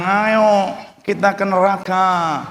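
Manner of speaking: lightly voiced tempting devil; devil's enticement. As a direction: coax them along, drawing out the invitation. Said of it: "Iblis bilang". (sedikit menirukan iblis yang membujuk)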